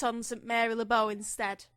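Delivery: light put-on Cockney accent. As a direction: read it as a hammed-up London Cockney. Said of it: "You have to do the accent".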